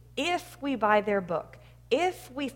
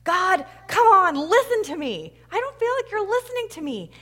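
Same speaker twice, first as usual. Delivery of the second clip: high, loud, complaining plea; acted pleading yell; 9 semitones higher, 7 dB louder than usual. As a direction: play a man yelling up at God. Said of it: "He keeps on yelling and pleading and complaining".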